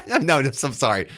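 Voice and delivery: apologising through a laugh. Real, not acted. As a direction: laugh while saying it. Cracks up at the played clip.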